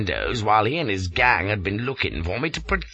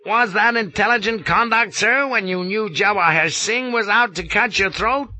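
higher, louder scolding colonel; rasping scold; 11 semitones higher, 4 dB louder than usual. A narrator's reading. Colonel rasping at Flashman for his foolish conduct, scolding. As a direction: rasp out a reprimand. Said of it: "and rasped".

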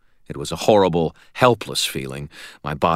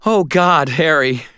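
high, raised cry of alarm; distressed cry; 10 semitones higher, 4 dB louder, slower than usual. A narrator's reading. Young woman embracing injured friend, distressed cry. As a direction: exclaim in shocked relief. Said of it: "She let out a little cry".

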